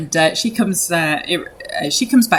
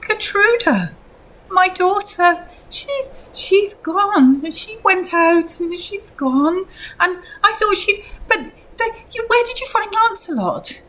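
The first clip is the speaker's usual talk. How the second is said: high, grief-struck mother's voice; distraught mother; 13 semitones higher than usual. Acted. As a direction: plead high, as if close to despair. Mother explaining daughter is gone.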